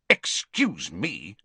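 ordinary talk

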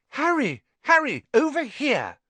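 put-on very high girl's call. A narrator's reading. Hermione hails Harry from the steps, high call. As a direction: call excitedly across a street.

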